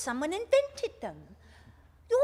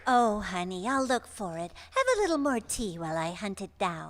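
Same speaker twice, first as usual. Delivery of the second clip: soothing; 7 semitones lower than usual. Acted. Mother soothing a frantic son.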